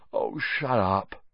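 slow, soft sleepy mumble; yawning. Acted. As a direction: say it through a yawn. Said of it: "Harry yawned".

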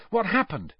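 high and keen; eager. A narrator's reading. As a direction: jump in eagerly, keen to know. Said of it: "said Harry eagerly".

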